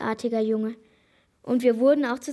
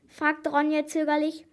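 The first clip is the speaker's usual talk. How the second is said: ordinary delivery, nothing marked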